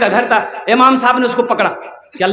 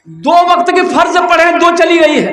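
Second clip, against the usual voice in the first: high-pitched, acted angry threat; mock-furious warning, pitched up; angry scolding; 6 semitones higher than usual. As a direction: snap at the bawling calf. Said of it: "तो इसको गुस्सा आया".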